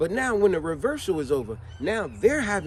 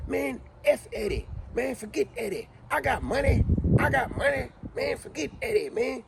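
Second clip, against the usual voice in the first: boastful heckler; 6 semitones higher than usual. Retold or imitated. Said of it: "He's like".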